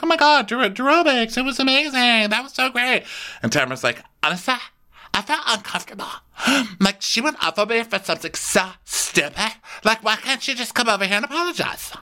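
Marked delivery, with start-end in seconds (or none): 0.0-3.0 much higher, sugary praise; mock gushing. Everyone gushes over aerobics. 4.2-11.9 much higher, aggrieved whine; wounded Tamara. Retold or imitated. Tamara quoted hurt.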